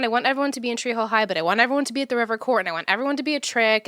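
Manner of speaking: fast excited listing; rapid-fire. Retold or imitated. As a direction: rattle it off quickly. Rattling off her own plan.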